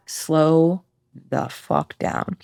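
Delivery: deliberately slow demo of slowing down. Shown as a demonstration. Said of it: "demonstrating slowing down".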